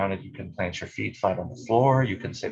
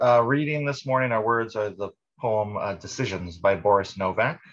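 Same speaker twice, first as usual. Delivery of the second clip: ordinary delivery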